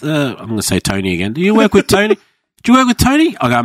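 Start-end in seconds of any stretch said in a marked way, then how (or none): 1.3-3.4 high, loud eager question; high eager question from a coworker. Retold or imitated. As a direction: ask it bright and keen. Coworker asking excitedly.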